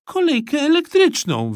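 put-on boy explaining. A narrator's reading. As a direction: spell it out for the grown-up. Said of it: "wyjaśnił Kleofas".

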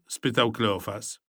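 usual speaking voice

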